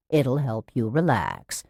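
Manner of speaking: low, slow coaxing; syrupy nurse calm; patronizing soothing. A narrator's reading. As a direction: lull her into agreeing. Nurse coaxing patient toward injection.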